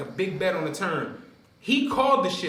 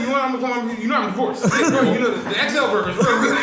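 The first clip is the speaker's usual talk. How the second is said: louder, higher, laughing send-up; teasing a friend who keeps mentioning divorce; mock impression; 5 semitones higher, 6 dB louder than usual. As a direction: overdo a friend bragging about his divorce. Mocking Spank's divorce talk.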